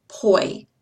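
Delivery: "poi." isolated, gliding o to i; poi syllable. Shown as a demonstration. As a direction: say just the first syllable "poi". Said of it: "you're going to hear the diphthong in the first syllable".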